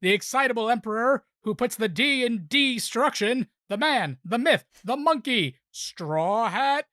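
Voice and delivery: building ring intro, ending in a drawn-out sung name; WrestleMania announcer. Acted. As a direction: ramp up the hype to the champion's name. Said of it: "in my best WrestleMania announcer voice".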